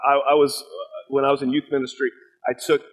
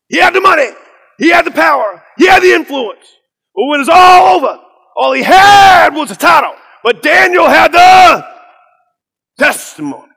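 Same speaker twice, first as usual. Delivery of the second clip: Pastor Kevin Cosby; 13 dB louder than usual. Retold or imitated. Pastor landing Daniel's testimony, shouted preacher climax. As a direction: build to a booming hold, then release.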